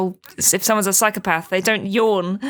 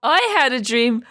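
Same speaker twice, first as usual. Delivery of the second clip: a yawn breaking into the words; yawny; 10 semitones higher than usual. Retold or imitated. Mocking her own morning recordings through a yawn. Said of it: "I'm a very yawny person. I'll be like".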